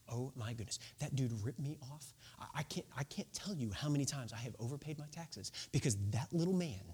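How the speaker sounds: a resentful neighbour mutters about the tax man; muttering. Retold or imitated. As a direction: complain under your breath. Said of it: "the crowd is muttering".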